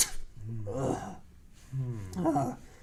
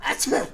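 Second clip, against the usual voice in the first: sneeze; 8 semitones higher, 5 dB louder than usual. Pretend. Dad pretends to keep sneezing.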